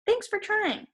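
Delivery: grateful friend. A narrator's reading.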